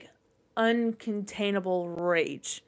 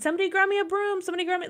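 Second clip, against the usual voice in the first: high, frantic call for help; panicked calling, retold; 10 semitones higher, much faster than usual. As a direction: call out in a reenacted panic, pitched way up. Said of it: "I was just fucking panicking".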